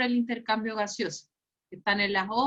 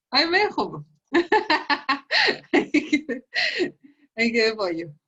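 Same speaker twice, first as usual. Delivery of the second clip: laughing; 4 semitones higher than usual. Real, not acted. Laughs at student's answer.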